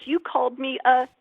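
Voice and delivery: loud, high, slow accusation; mock-distraught patient, deeply offended; retold sobbing. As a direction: drag it out like a wounded patient. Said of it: "He's absolutely crying hysterically".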